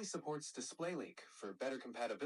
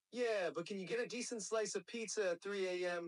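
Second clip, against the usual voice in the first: higher-pitched New Yorker comeback; New York accent character; 6 semitones higher than usual. Shown as a demonstration. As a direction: talk smack like a New Yorker. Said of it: "New Yorker".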